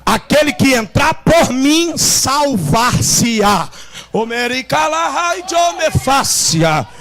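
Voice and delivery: loud, high shouted sermon with music; fiery preaching. Acted. Pastor shouting the sermon to his church, fervent. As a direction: preach it at full force to the congregation.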